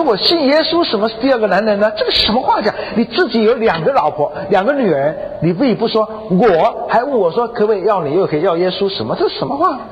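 high-pitched indignant retort; acted-out affronted wife arguing back. Wife indignantly rebuts husband's demand.

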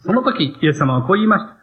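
usual speaking voice

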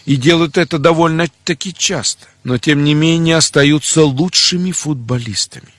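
ordinary talk, nothing marked

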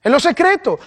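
ordinary talk, nothing marked

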